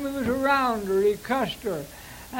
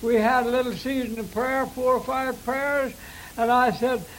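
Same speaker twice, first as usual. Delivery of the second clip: ordinary talk, nothing marked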